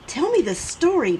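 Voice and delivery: high, eager pleading; begging. A narrator's reading. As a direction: plead for the story. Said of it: "Petey begged".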